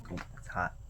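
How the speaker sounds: a real whispered complaint about the heat; whispered aside. Real, not acted. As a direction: mutter it to yourself under your breath. Aside about heat while recording.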